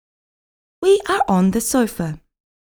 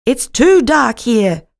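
crying out; 2 semitones higher, 5 dB louder, slower than usual. A narrator's reading. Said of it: "cries Blackie".

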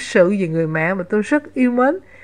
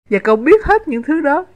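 higher, wide-eyed and amazed; naive admiration; 6 semitones higher than usual. A narrator's reading. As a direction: ask in innocent awe. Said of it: "hỏi, thật ngưỡng mộ một cách ngây thơ".

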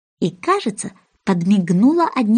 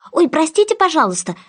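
rattled apology; 7 semitones higher than usual. Acted. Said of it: "затараторила Алиска".

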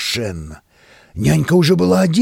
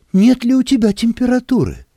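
worried nanny voice; 9 semitones higher than usual. A narrator's reading. Worried nanny asks again.

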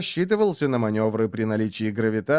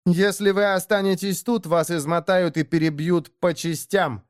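higher-pitched, cutting warning; spat out; 8 semitones higher than usual. A narrator's reading. Деэмира warns the orc they will be worn down, spat out. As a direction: spit the words at the orc with mild venom. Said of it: "выплюнула Деэмира".

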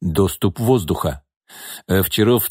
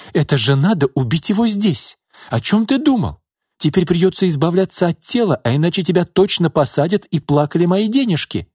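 much higher agitated woman; panicking mistress; 8 semitones higher than usual. Acted. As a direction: fret aloud, high and tense. Lina voiced, agitated.

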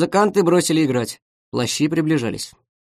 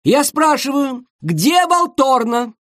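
high, slow, furious question; angry demand; 10 semitones higher, 3 dB louder, slower than usual. Acted. Conductor angrily demanding the missing horn. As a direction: conductor barks at the orchestra.

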